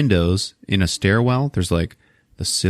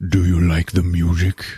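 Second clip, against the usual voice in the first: creepy killer; 2 semitones lower than usual. Retold or imitated. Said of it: "And then you just hear".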